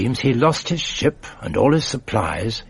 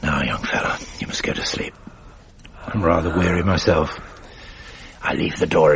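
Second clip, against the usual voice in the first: gentle goodnight; 5 semitones lower than usual. Acted. Father settles boy to sleep.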